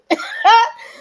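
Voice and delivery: bursting into high, loud laughter; real laugh. Real, not acted. Viewer comment cracks her up, loud high laugh.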